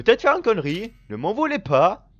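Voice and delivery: loud, high playful plea; hyping up a reckless move; excited warning. Real, not acted. Gamer loudly begging viewers not to blame him.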